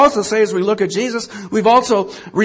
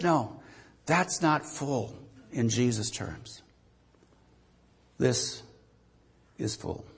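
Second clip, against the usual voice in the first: hushed reverent quote; 8 semitones lower, 9 dB quieter than usual. Retold or imitated. Quoting John, hushed reverence.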